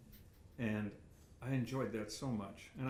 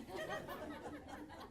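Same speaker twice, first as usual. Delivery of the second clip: a quick, high, airy laugh, much softer; high breathy chuckle; 10 dB quieter than usual. Real, not acted. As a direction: chuckle at your own messy notes. Laughing at own messy notes.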